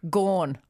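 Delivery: gone with an open aw vowel, like gorn; her way of saying gone. Shown as a demonstration. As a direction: say gone the way she grew up saying it. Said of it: "Go on, say it".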